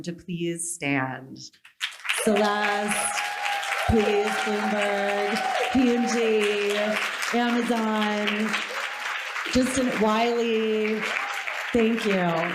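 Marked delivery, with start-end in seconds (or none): 2.2-12.5 calling names out. Real, not acted. Calling names over applause.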